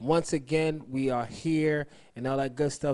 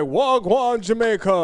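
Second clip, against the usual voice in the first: slow, higher fake Jamaican wagwan; a mock Jamaican accent done slowly and a bit louder; mock Jamaican greeting; 7 semitones higher, 9 dB louder, slower than usual. Host mocking a DJ's fake Jamaican accent. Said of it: "They be like".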